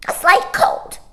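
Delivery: high, loud huffy bird denial; indignant starling. A narrator's reading. Starling hiding his tears from Mary Poppins, huffy and high. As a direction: deny it with wounded dignity. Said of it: "drew himself up".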